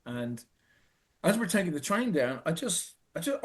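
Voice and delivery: ordinary talk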